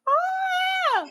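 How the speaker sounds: high startled shriek; mock freak-out cry; mock panicked shriek. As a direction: squeal like a panicking new parent. Friends panicking over parenting.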